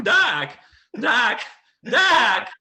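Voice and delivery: high, loud squawked impression; Michael J. Fox. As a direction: squawk it like a stepped-on duck. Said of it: "like, stepping on a duck".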